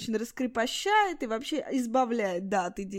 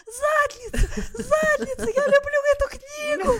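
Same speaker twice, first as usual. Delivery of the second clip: high, loud delighted yelling; shrieking with joy about the book; retold child's yelling; 8 dB louder than usual. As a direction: yell it like a thrilled little boy. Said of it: "начинает истошно орать".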